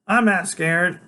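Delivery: put-on high, loud, lofty kid genius; Freak's voice. A narrator's reading. Freak insisting bravely.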